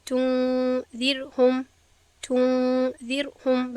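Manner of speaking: تنذرهم chanted very slowly, nun hummed through the nose; slow Quranic تنذرهم; ikhfa recitation. Shown as a demonstration. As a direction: recite تنذرهم with a long nasal ghunna. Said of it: "برای حرفی ذل مثال".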